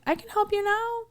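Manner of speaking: bright, high customer-service voice; hammed-up eager waitress; imitating herself, perky. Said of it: "I'm like".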